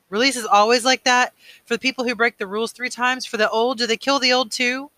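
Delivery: high, loud, urgent questions; horrified questioning. A narrator's reading. Boy learning how the old die, horrified high questions. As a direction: press each question in rising disbelief.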